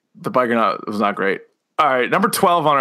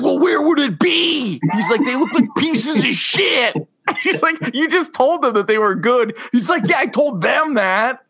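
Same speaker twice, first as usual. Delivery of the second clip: a hammed-up high whiny voice; mock squabbling man; 10 semitones higher than usual. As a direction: defend yourself like a caught liar. Retold couple's squabble, high voices.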